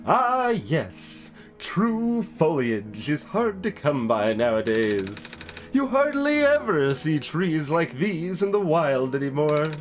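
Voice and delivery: talking plant holding forth with stretched words; potted plant voice. Acted. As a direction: declaim as an animated potted plant. Voices plant-keeper character in game.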